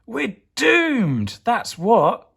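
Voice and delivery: high, loud "we're doomed"; despairing cry. Acted. Cucumber despairing at the pea attack, high cry. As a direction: wail in despair. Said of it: "cried Cucumber".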